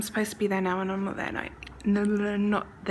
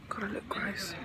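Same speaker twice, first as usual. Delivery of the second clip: whispering; 6 dB quieter than usual. Real, not acted.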